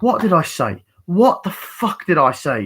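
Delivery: mock irate telling-off; acted angry scolding. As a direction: demand it like a fed-up partner. Acted character scolding the other.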